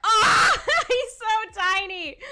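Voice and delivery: high, loud delighted squeal; real cooing delight. Real, not acted. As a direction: squeal at how tiny. Sees size difference.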